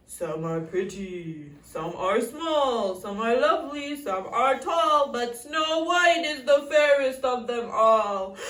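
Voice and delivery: slow, loud, droning intonation; magic mirror. A narrator's reading. Magic mirror answers the queen, slow solemn intoning. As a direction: chant it slowly as an oracle, starting low. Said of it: "The mirror answers".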